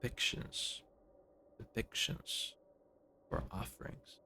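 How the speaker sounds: muttered under breath, unvoiced; trying the words out in a whisper. Real, not acted. Player weighing two dialogue options in a whisper. Musing over word choice quietly.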